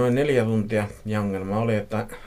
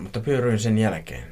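plain reading voice

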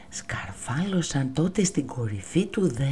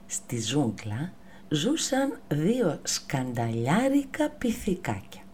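usual speaking voice

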